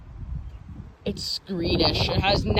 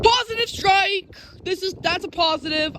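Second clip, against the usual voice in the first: high, thrilled yell; excited shout; 10 semitones higher than usual. Real, not acted. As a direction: call out the strike in awe. Catches a lightning strike.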